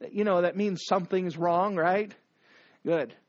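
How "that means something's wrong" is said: ordinary talk, nothing marked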